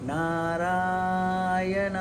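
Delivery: very slow, long stretched ओम; a bit drawn out. Shown as a demonstration. Guru showing devotees how to chant the mantra long and slow. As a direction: draw out the mantra, unhurried. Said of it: "थोड़ा लंबा".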